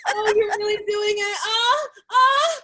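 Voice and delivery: high, hammed-up moaning; faked ecstasy. Shown as a demonstration. Operator faking it for a caller while smoking weed, mock ecstatic moans. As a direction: overact mounting pleasure, high and loud.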